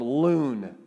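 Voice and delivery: loon said low and drawn out, long oo; Hebrew word loon. Shown as a demonstration. Preacher teaching congregation the Hebrew word loon slowly. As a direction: sound out loon slowly and deeply. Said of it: "Say loon".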